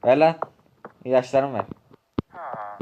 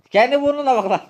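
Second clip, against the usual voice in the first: much higher, loud angry shout; öfkeli bağırış; 11 semitones higher, 4 dB louder than usual. Acted. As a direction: blast him for the insult. Doktora burnunu sil deniyor. (öfkeyle bağırarak karşılık verme)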